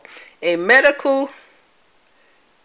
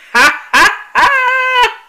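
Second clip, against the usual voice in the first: loud laugh; 7 dB louder, much slower than usual. Real, not acted. Laughing at his own joke.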